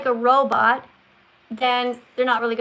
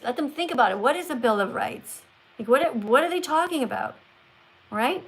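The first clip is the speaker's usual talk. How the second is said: ordinary delivery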